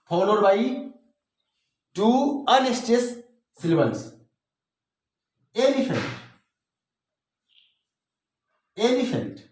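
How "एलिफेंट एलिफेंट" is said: Elephant with weight on ए, then falling; stress on first syllable. Shown as a demonstration. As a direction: lean on ए and drop off through लिफेंट in elephant. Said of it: "ये stress हो गया".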